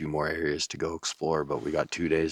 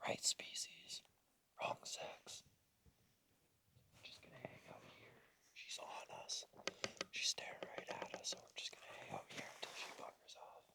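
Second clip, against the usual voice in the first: hushed whisper so the deer won't hear; wildlife watching whisper; 22 dB quieter than usual. Real, not acted. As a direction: whisper it, barely breathing. Whispering near a mule deer.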